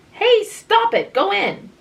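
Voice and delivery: high, loud scold; scolding the mole. A narrator's reading. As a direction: shoo it back in sharply.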